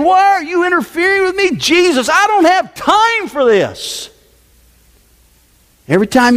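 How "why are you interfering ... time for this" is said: possessed man. Retold or imitated. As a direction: whine it like a cornered demon. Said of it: "And he's saying".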